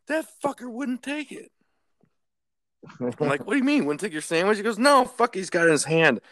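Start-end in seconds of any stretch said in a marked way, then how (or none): 0.1-1.5 high-pitched incredulous complaint; retold indignation. Coworker reporting the refused sandwich, high-pitched indignation. 3.4-6.2 high, loud, incredulous; retold heated exchange. Two coworkers arguing over refused sandwich, loud incredulity.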